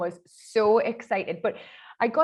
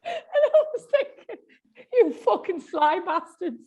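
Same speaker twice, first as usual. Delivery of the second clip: high, slow laughing outrage; mock indignation; 10 semitones higher, 4 dB louder than usual. Presenter cursing producers over subtitles, mock indignant and laughing. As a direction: draw the insult out, high and laughing.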